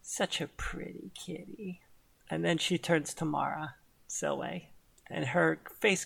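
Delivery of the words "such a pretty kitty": soft, much quieter, silky villain; purring villain. Acted. Celine teasing Mist after his handshake, soft purr. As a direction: purr it at the cat.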